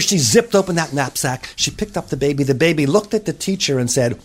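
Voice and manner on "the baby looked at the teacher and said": ordinary delivery, nothing marked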